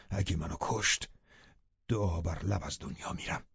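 low, very soft murmur; quiet, low-pitched resignation; hushed vow. A narrator's reading. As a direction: murmur a solemn promise to your beloved. Lover vows to stay and die.

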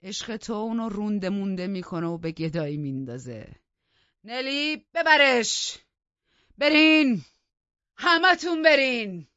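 loud, building to high shouts; furious dismissal. A narrator's reading. Heathcliff orders Nelly and everyone out, furious dismissal. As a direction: burst out and roar them away.

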